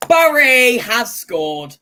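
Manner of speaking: loud, slow stretched goal shout; mock commentator goal roar; goal call. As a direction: bellow it like a goal announcer.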